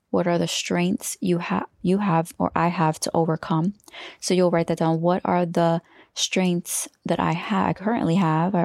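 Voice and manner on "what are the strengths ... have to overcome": normal talking voice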